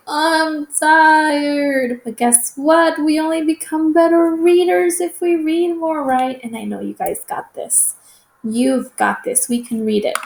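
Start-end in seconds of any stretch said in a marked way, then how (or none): 0.1-2.0 higher mock-whiny I'm tired; whiny kid. Teacher mimicking a reluctant reader, mock whine. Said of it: "some of you are gonna say". 2.0-6.4 encouraging. Real, not acted. Teacher rallying tired readers.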